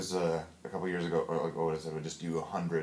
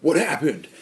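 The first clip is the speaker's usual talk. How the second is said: surprised shout; 8 semitones higher, 12 dB louder than usual. Pretend. Mock dramatic scene voice.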